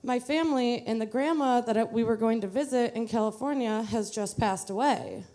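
usual speaking voice

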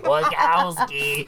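slow, drawn-out Roz drawl. Acted. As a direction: drag the name out dryly. Continues Roz impression.

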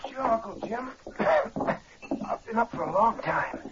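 soft, hoarse head-cold voice; faked hoarse voice. Impostor passing as Jim's sick nephew, faked hoarse voice. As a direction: fake a sore throat, keeping it quiet. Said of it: "This cold's made me hoarse".

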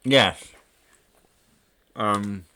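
talking while eating a biscuit; words round a biscuit; mouth full. Real, not acted. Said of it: "sorry, I'm eating a biscuit".

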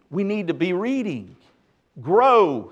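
usual speaking voice

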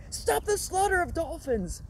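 slow, high, drawn-out protest yell; mock protester shout. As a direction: bellow it like a placard slogan. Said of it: "this lady's like".

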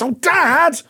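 high, drawn-out "Dad!" in protest; exasperated son. Retold or imitated. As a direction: imitate an embarrassed kid pushing back. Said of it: "that kind of ... almost pushing back".

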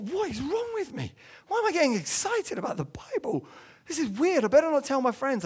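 high, wondering schoolboy voice, lightly played; his younger self, puzzled. Preacher recalls himself as a boy in RE class, high and bewildered. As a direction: play the baffled teenager excited by the Bible. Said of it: "I thought".

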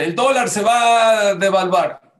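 emphatic declaration. Retold or imitated. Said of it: "cualquier persona dice".

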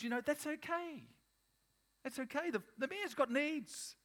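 very high-pitched, mocking excuse-making voice; mock permissive world. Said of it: "in the world is they're saying".

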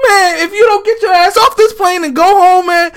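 high, loud shout at a movie hero; yelling at the screen. Retold or imitated. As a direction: holler at him to get off the plane. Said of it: "I was like".

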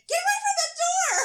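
very high, loud possessed shriek; Regan from The Exorcist. A narrator's reading. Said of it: "I screamed like Regan from The Exorcist".

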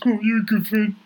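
high pleading ask for a hug; lightly voiced grateful friend; Billy's voice. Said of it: "He goes".

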